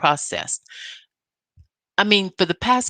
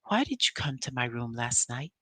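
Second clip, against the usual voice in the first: much softer, low and calm question; 5 semitones lower, 10 dB quieter than usual. Retold or imitated. Mel asking Martell about his late visit, calm and quiet. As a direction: ask it quietly, holding back the hurt. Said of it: "in a calm voice".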